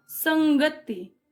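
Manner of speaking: संगति stretched slowly, with ng clearly sounded; slow pronunciation. Shown as a demonstration. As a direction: show how संगति is actually said. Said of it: "उच्चारण में". (संगति धीरे से, अं की ध्वनि साफ़)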